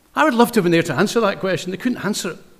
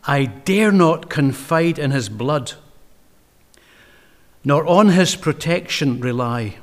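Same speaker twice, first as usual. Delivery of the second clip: slow reading; 2 semitones lower, slower than usual. Shown as a demonstration. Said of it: "Now listen to this".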